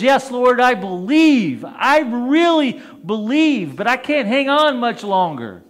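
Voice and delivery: high, loud, desperate pleading; strained pleading. Retold or imitated. Man clinging to a branch pleads with the Lord, high and strained. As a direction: beg while barely holding on. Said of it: "And he says".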